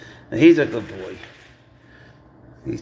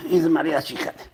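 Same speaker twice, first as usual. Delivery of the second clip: ordinary talk, nothing marked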